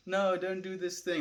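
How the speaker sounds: high-pitched inner protest; voice of resistance. Acted. Streamer voicing his own resistance to a task, high-pitched protest. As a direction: voice a reluctant inner objection up high. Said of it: "I feel that".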